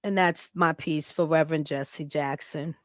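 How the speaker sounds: normal talking voice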